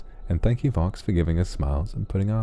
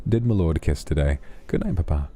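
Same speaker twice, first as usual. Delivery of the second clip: ordinary talk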